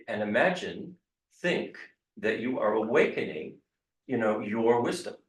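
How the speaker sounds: normal talking voice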